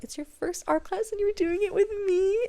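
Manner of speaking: high, sweet coo; gushing to a child. Retold or imitated. Teacher to little girl at first art class, gushing. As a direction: coo upward in delighted disbelief.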